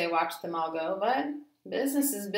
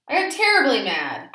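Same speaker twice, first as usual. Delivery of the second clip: angry; 7 semitones higher, 10 dB louder than usual. A narrator's reading. Narrator getting mad.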